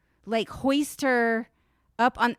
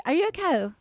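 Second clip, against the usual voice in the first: mock Irish accent; 5 semitones higher, faster than usual. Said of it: "can't do my Irish".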